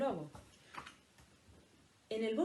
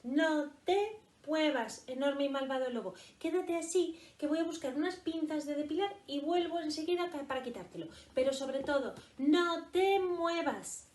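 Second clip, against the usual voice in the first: coaxing rabbit; 7 semitones higher, 3 dB louder than usual. Acted. Rabbit orders wolf to wait.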